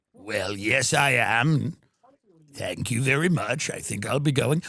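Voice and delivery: a deep-voiced tycoon politely ending a call; Wexner voice.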